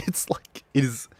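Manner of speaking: breathless whisper. Real, not acted. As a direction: whisper it in awe. Whisper after laugh.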